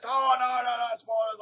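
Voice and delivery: high, loud frightened protest; mock panicked shout; alarmed. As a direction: back away from the spider in fright. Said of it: "he says".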